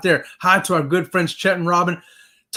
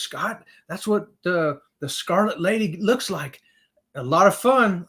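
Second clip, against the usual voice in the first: ordinary talk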